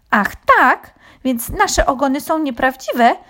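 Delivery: high, loud affronted squawk; offended cry, pitched well up; indignant hens. A narrator's reading. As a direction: challenge it in outrage. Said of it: "zawołały oburzone kury".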